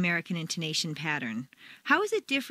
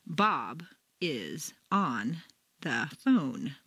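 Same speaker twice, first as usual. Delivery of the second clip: very slow, lower, each word isolated; 6 semitones lower, much slower than usual. Shown as a demonstration. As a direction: separate every word with a clear pause, mechanically. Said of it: "Do not speak word by word".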